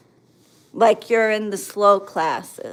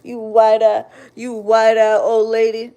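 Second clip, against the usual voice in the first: imitated thug insult, pushed louder; put-on tough-boy jeer; mocking thug talk; 4 dB louder than usual. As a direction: mimic a disrespectful kid trash-talking. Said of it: "They talk like that".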